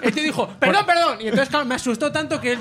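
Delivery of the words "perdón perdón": much higher, louder hurried apology; flustered mock apology. Dog owner apologising to the storyteller, hammed-up fluster. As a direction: blurt out an exaggerated sorry.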